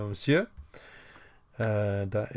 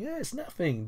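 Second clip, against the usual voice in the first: light high aside; 11 semitones higher, 4 dB quieter than usual. Shown as a demonstration. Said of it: "Don't mention it".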